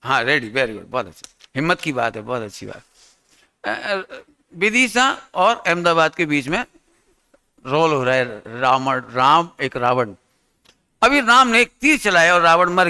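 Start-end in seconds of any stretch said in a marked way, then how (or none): none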